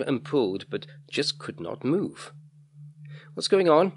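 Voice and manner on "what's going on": higher, louder panicked question; alarmed shout. A narrator's reading. Boy stuck to the bench demands answers, shouting. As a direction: cry out in alarm. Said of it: "he shouted".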